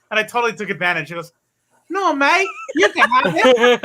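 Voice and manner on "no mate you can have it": lightly put-on Australian accent, high; a hint of an Australian friend being polite; mock Australian accent. Friend politely offering the comic in a mild Australian accent. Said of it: "That's not me making fun of it".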